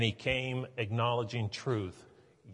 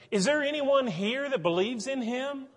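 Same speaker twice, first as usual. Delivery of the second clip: much higher, outraged question to the room; indignant challenge; 11 semitones higher, 4 dB louder than usual. Retold or imitated. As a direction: demand an answer from the council.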